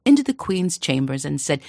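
ordinary delivery, nothing marked

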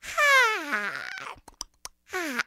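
high, long yawn falling away; acted yawn. Rabbit about to nap, yawning widely. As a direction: yawn big, then smaller.

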